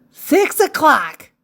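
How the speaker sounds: high, bright-pitched call of the hour; lifted, announced clock time; time announcement. Acted. Calls out story time.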